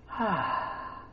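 soft, voiceless sigh of escape; relieved sigh. Acted. Preacher imagining just getting away, quiet relieved sigh. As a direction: exhale long and softly.

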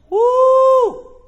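long, high, loud "woo"; admiring whoop. Real, not acted. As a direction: whoop with delight. Whoops over fine linen.

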